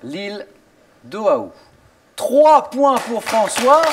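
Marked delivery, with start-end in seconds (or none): 2.1-3.8 excited announcement. Acted. Host announces score to contestant.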